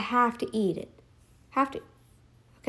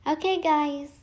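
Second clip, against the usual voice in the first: ordinary delivery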